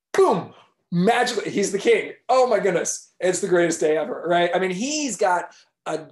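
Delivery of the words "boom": a sudden loud magic boom. A narrator's reading. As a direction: punch it out like a blast. Magic transformation sound.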